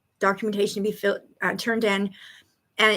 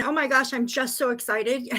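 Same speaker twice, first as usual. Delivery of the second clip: ordinary talk, nothing marked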